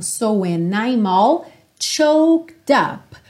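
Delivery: slow, "all" linked; linked words. Shown as a demonstration. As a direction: join each end consonant to the next vowel. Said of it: "consoante ligando na vogal".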